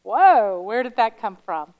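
loud startled whoa; surprised whoa. Retold or imitated. Speaker answering mother's remark, startled and loud. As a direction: react in mock shock. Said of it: "I'm like".

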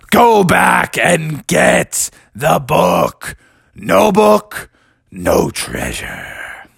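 theatrical drawn-out menace; scary voice. Acted. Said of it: "your voice is pretty scary".

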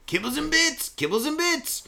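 high, sing-song ad-jingle voice; dog food jingle voice. Retold or imitated. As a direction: chant it like an old TV commercial. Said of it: "catchphrase in put-on voice".

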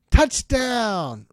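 loud high cheer of a fan; cheering a touchdown; mock touchdown cheer. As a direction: shout it like a fan in the stands.

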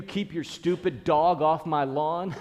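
half-joking irate homeowner; mock angry neighbour. Acted neighbour's insult, then joke excuse.

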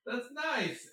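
high, very slow syrupy reply; mock-sweet dismissal; imitating actor. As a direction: drag it out patronizingly. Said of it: "And he's like".

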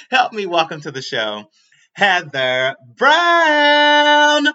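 drawn-out, loud, high announcer name; show host intro. Acted.

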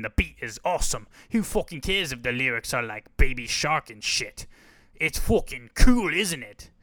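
hammed-up British accent, dismissive; British bloke. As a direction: brush off the critic like a rowdy British fan. Imagined British fan argues back.

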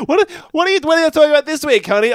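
high mock wife voice; mocking imitation. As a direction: parrot him in a high squeaky voice. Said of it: "you said".

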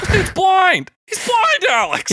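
very high, excited shout of disbelief; incredulous shouting. Real, not acted. Host tells co-host the juggler is blind, incredulous high shout. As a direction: shriek it at your friend in sheer amazement.

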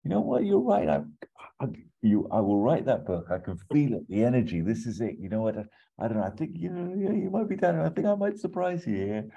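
high, giddy burst of inspiration; excited Cusack. Retold or imitated. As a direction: sell the sudden big idea, voice soaring. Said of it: "Cusack's like".

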